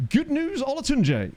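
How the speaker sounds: high squeaky announcement; high-pitched mock announcer; squeaky good news. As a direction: chirp it up high like a gag. Reading joke names in a squeaky voice.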